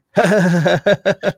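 high chattering alien laugh; Nien Nunb laugh. Shown as a demonstration. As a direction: puppet alien giggle. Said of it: "when you see him laughing".

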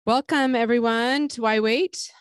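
bright, loud show greeting; excited welcome. Real, not acted. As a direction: open the show with energy. Host opening the podcast.

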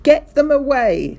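loud, high-pitched angry yell; screamed. A narrator's reading. Mister Hazel sees birds fouling his car, screaming. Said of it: "screamed Mister Hazel".

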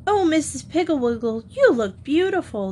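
high, delighted gasp of praise; gushing girls admiring; exclaiming. Acted. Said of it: "the girls exclaimed".

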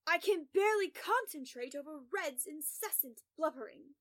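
loud, irritated outburst; exasperated growl, hitting the first words hard; agitated growl. A narrator's reading. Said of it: "he growls".

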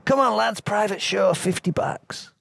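high-pitched tout's pitch; strip-club tout. Acted. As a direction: call out like a tout luring punters inside. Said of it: "where touts hovered outside the strip clubs trying to tempt people".

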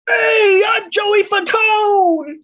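high, loud, drawn-out shouted intro; loud, slow, high announcement of himself. Acted. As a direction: bellow the name slowly like a big entrance. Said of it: "Joey Fatone cries in the distance".

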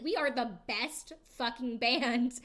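loud, slow, emphatic boast; imitated proud elation. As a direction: play someone thrilled with the band. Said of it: "it truly feels like he's like".

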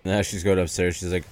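ordinary talk

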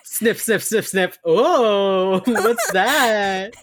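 mock sniffing, high and loud, laughing through it; an exaggerated, teasing ooh of discovery; nosy Derek. Host imitates Derek sensing something between two flirting colleagues, comically nosy.

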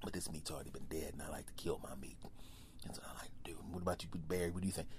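low hushed whisper; lion whispering to the bear Barry; conspiring lion. Acted. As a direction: whisper to a partner in crime. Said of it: "And the lion's like".

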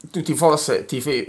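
ordinary delivery

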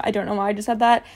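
plain reading voice

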